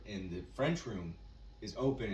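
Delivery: usual speaking voice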